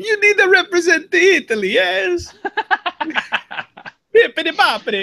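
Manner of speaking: high, hammed-up Italian accent; cartoonish Italian dad accent. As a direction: play the pushy Italian father in a goofy accent. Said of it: "the dad's accent".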